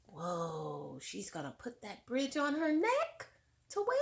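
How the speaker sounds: ordinary delivery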